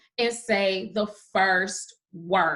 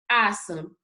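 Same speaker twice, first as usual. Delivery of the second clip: ordinary delivery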